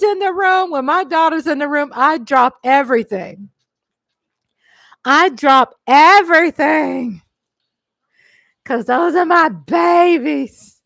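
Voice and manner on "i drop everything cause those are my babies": emphatic. Real, not acted. About daughters.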